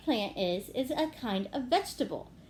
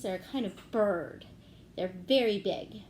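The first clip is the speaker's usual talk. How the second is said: ordinary delivery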